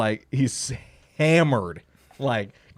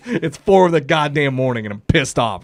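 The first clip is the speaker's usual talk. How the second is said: normal talking voice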